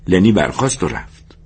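ordinary delivery

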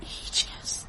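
barely audible whisper; hushed admission. Acted. Mother admits to therapist nobody stops her, whispering. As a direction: let it out almost without voice.

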